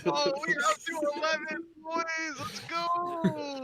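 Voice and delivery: excited announcer call; hyped count. Real, not acted. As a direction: cheer the count on like a hype man. Hyping the rising joke count.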